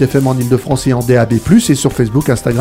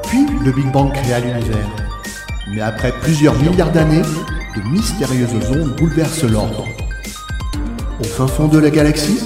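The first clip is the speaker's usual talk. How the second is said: put-on old sci-fi announcer; 2 semitones lower than usual. Cosmic intro over music.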